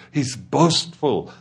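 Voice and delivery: usual speaking voice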